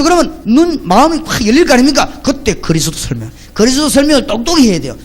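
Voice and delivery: normal talking voice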